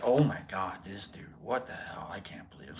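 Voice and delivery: imitated aghast whisper; scandalised whisper. Viewers behind screens reacting, imitated shocked whisper. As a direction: drop low into a whispered aside.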